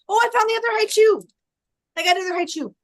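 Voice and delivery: high, loud happy find; delighted little cry of discovery; excited. Real, not acted.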